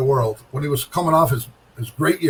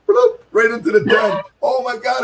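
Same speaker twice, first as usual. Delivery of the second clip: excited bloop; 9 semitones higher, 3 dB louder than usual. Retold or imitated. Six pin falling into ten.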